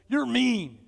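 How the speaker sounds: high, loud imitation of an upset boy; mock indignant kid outburst; upset child. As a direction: pout it loudly like a cross child. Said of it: "Then he was upset".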